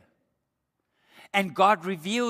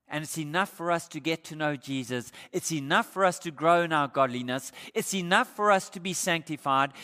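ordinary talk

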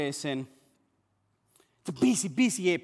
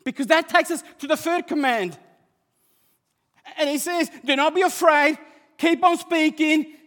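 high, loud, driving sermon voice; full-force preaching; 9 semitones higher, 9 dB louder than usual. Real, not acted. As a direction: build to a shout as the story peaks.

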